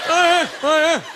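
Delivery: put-on high, punchy Canadian guy accent. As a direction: agree emphatically in a mock Canadian accent. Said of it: "this funny ass way of talking".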